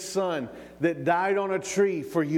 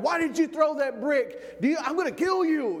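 enraged shout; 10 semitones higher than usual. Retold or imitated. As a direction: yell it high, as if confronting the culprit. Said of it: "began to shout at him".

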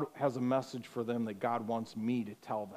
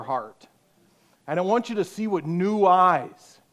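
louder urgent appeal; louder preaching at full force; fervent preaching; 5 semitones higher, 9 dB louder than usual. Real, not acted. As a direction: drive it home to the youth. Sermon climax, preaching force.

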